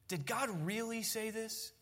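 soft, slow insinuating question, lightly acted; quietly sow doubt like the tempter; deceiving Satan. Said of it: "one of Satan's favorite methods is to deceive".

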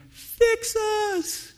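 high pleading cry. Retold or imitated. People crying out to Washington, high plea. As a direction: cry out for rescue. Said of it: "looking to Washington going".

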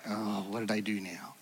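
put-on low, soft guilty-child mumble; sheepish kid. Preacher playing the scolded child, mock sheepish. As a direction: sound like a kid caught again.

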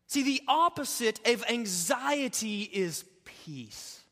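slow, deliberate pulpit proclamation; measured preacher announcing the answer; weighty declaration. Acted. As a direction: land the turning point slowly. Turning point, slow weighty.

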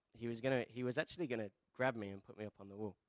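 ordinary talk, nothing marked

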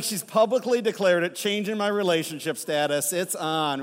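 imitating a thrilled boyfriend, loud; stoked. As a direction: crow it like it's official at last. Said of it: "that guy was stoked".

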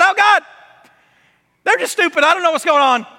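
pleading Hezekiah. Retold or imitated. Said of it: "This is [name]' paraphrase".

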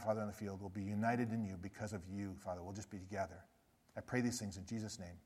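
soft, reverent prayer voice fading low; closing prayer. Acted. Pastor blessing the church and departing team, hushed and reverent. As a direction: pray it quietly over the church.

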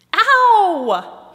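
loud, high, long yelp of pain; cried out. A narrator's reading. Head crashes into the cherry trunk, crying out. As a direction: cry out as if you just crashed. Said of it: "he cried".

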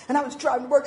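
usual speaking voice

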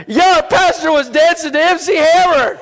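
high, loud mock report to friends; excited churchgoer. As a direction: gush loudly like an amazed congregant. Imitates congregants telling others about church.

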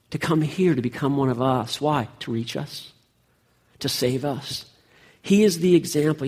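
ordinary delivery

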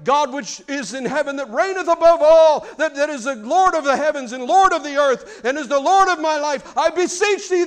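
loud, high, pompous King James prayer; fancy King James prayer. Retold or imitated. Preacher mocking fancy church prayers aloud. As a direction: ham up a lofty churchy prayer. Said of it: "we can wax eloquent".

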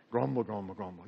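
very low, quiet rumble; ugly crowd grumbling. Retold or imitated. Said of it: "Ooh, that sounds ugly".

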